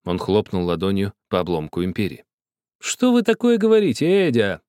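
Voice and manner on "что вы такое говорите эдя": high indignant huckster's cry; haggling dealer. A narrator's reading. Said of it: "воскликнул Бабель тоном перекупщика".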